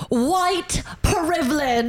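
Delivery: slow, high, drawn-out announcement; announcer woman. Retold or imitated. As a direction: announce it like a reality-show clip. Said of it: "woman announcing it like that".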